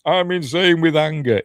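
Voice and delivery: high, loud imitated outburst; mock anger. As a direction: shout it like a hammed-up film line. Acts out film character's angry shout.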